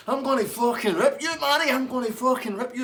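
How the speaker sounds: high, loud yelled threat; furious threat. Retold or imitated. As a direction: scream it like you're lunging over the bar. Dave threatening barman across bar.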